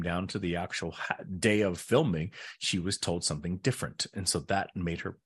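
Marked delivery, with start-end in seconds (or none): none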